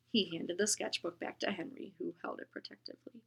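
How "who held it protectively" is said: ordinary delivery, nothing marked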